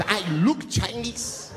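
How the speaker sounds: shrill mock outrage with a put-on Korean accent; mock angry Korean guy. Comic mimicking an offended Korean man, hammed-up accent. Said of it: "They will flip out".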